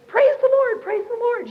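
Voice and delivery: high, loud gushing praise; gushing patient. Retold or imitated. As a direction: mimic the roommate's ecstatic chant.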